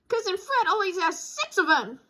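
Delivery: high, eager cub voice; eager child voice. Acted. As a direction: push the point like a kid. Brother arguing.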